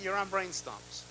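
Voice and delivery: usual speaking voice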